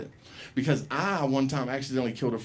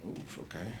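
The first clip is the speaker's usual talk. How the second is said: meek okay; 5 semitones lower, 17 dB quieter than usual. Retold or imitated. Kid meekly agreeing.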